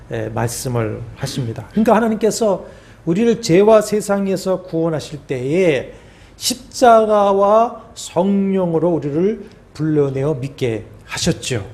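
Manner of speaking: ordinary talk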